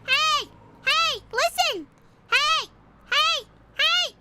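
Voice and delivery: Navi voice. Pretend. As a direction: pester the hero with shrill calls. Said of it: "Navi voice".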